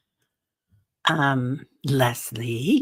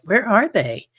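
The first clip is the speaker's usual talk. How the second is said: mock surprised; 8 semitones higher, 5 dB louder than usual. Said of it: "and I was like".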